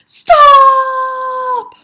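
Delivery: high, long-held full shout; very loud yell. A narrator's reading. Taya picked up by the hair, yelling. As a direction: yell it out at the top of your voice. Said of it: "Taya yelled very loudly".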